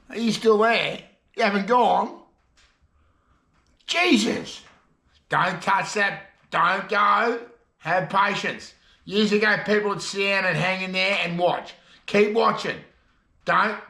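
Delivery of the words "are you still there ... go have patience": higher-pitched, insistent plea to viewers; playfully exasperated pleading; mock alarm. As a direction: call after someone about to leave, hammed up. Mock panic at viewers leaving.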